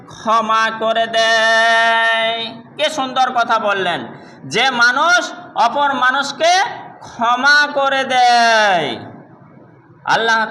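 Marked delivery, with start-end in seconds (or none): 0.1-2.5 very slow, held final word; drawn-out emphasis. Real, not acted. Preacher teaching whom Allah loves, drawn-out emphasis. 4.5-9.0 slow, measured phrases with a long final word; emphatic recital. Real, not acted. Preacher repeating the teaching on forgiveness, emphatic recital.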